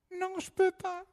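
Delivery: high, soft little voice; tiny candle voice. Acted. The little tealight candle refusing the hiker, high and soft. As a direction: sound small and sorry.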